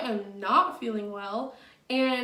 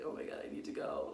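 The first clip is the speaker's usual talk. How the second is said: fast whispered panic through a laugh; whispered near-tears line with a laugh; tearful whisper; 12 dB quieter, faster than usual. Retold or imitated. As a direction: whisper it, fighting back tears. About to cry at clinic.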